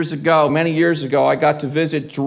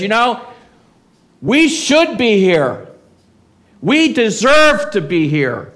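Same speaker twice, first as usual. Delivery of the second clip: the thief's confession, louder, weighing "deserve"; louder admission; 5 semitones higher, 5 dB louder than usual. Retold or imitated. Preacher voicing the repentant thief confessing his guilt, louder. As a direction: own it out loud, stressing "deserve".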